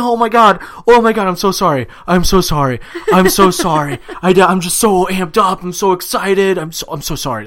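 high, loud, overwhelmed apologizing; O'Reilly. Retold or imitated. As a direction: imitate an emotional winner gushing apologies. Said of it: "kept apologizing over and over".

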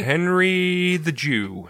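slow mock New York gangster voice; Goodfellas accent. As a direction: stretch it like a Goodfellas wiseguy. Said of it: "That's what that accent reminds me of".

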